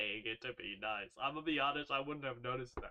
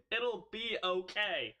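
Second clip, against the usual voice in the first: slow retake; 6 semitones higher, 5 dB louder, slower than usual. Shown as a demonstration. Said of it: "what the fuck did I just say?".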